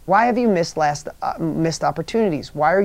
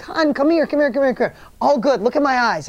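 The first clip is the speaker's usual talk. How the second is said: higher-pitched, repetitive coaxing; retold coaxing reassurance; 6 semitones higher than usual. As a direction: coax a shaken stranger to come close. Retold comforting of shaking woman.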